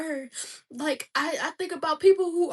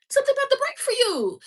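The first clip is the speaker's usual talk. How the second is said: high, loud, fast declaration; preacher's excited burst of hope; fervent proclamation; 12 semitones higher, 5 dB louder, faster than usual. Real, not acted. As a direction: shout the promise out to them. Proclaiming a breakthrough to listeners.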